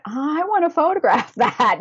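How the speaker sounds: high, laughing excited plea; eager tourist. Retold or imitated. On the bus, pointing out the window, high and excited.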